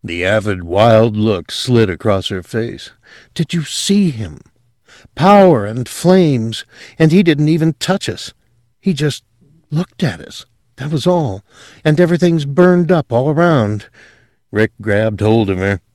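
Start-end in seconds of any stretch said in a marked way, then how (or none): 3.4-13.9 put-on a lightly raised, rapt young woman; lightly voiced girl marvelling at angels; Sylvia's awed voice.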